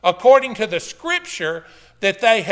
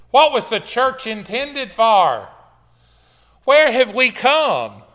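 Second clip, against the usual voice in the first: building higher and louder, slow and emphatic; impassioned challenge; 4 semitones higher, 5 dB louder than usual. Acted. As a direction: preach Paul's questions at the leaders, drawing them out. Acts out Paul challenging church leaders.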